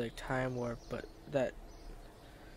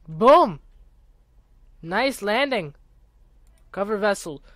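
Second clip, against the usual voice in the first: excited; 4 semitones higher, 5 dB louder than usual. Real, not acted. Celebrating the landing.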